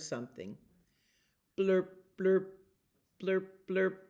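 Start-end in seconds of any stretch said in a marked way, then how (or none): 1.6-4.0 blurp sounds. A narrator's reading. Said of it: "It was low and beautiful".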